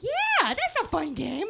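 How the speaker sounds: put-on high, slow kid voice; kids agreeing. Preschoolers answering the teacher, high and slow. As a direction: drawl it like a happy little kid. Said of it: "And they're like".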